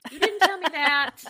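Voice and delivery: laughing mock complaint. Real, not acted. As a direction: say it through a laugh. Mock accusation repeated laughing.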